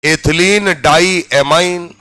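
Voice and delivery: slow, drawn-out term for note-taking; slow classroom dictation. Shown as a demonstration. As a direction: dictate it slowly, stretching each part. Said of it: "नाम लिखिए".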